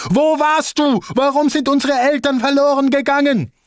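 high, loud accusing relative voice; angry relatives. Retold or imitated. Relatives on the phone blaming him, high and angry. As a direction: shout the blame down the phone. Alle waren wütend auf mich.

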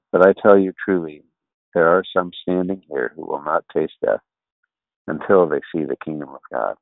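scripture reading. Acted. Reads Bible passage aloud.

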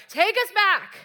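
high, loud mock wail of protest; acted demand. Israelites begging God to return to Egypt, acted loud demand. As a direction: cry it out as an indignant crowd.

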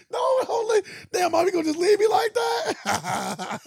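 high, loud, drawn-out fake wail; mock crying plea. Retold son left by his mom, high wailing imitation. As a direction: bawl like an abandoned kid, hammed-up. Acting son begging mother to stay.

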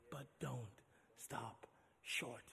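whispered refrain over quiet music. Real, not acted. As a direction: breathe it almost silently.

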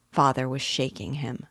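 plain reading voice

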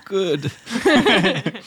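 high, teary genie; a genie about to cry. Acted. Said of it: "start to cry a little bit".

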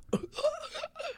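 very high squeal of a laugh. Real, not acted. Host cracking up at his own impression, high squeal. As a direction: let out a high shriek of laughter.

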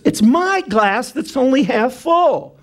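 high, drawn-out whine of a needy diner; self-centred complaint. Acted. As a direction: complain slowly, stretching it out high. Acts out self-centred restaurant customer.

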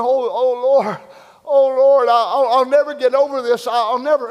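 high, loud mock lament; acted lament. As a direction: cry out to the Lord as a mourner, stammering. Acts out a mourner's lament.

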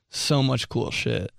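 ordinary talk

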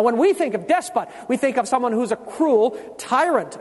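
ordinary delivery

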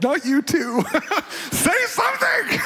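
very high, laughing half-cry plea; laughing mock wail. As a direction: wail at the silent friend through laughter. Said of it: "I'm like".

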